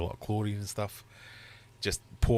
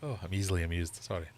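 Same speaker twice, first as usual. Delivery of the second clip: ordinary talk, nothing marked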